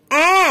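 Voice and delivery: "Uh" drawn out long; a lengthened, sustained "uh"; long vowel. Shown as a demonstration. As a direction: stretch and hold "uh". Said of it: "এটা একটু দীর্ঘ টান হবে".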